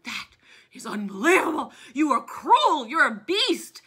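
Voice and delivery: loud indignant scolding; loud shocked outrage; outraged townsfolk. Retold or imitated. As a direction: accuse him loudly, appalled.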